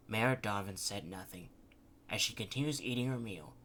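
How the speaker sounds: ordinary delivery, nothing marked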